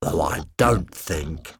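slow, cold threat; menacing Kevin. Acted. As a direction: lean into each word, in control.

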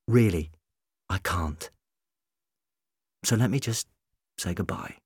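soft, halting goodbye; sad farewell. A narrator's reading. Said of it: "real sadness in his eyes".

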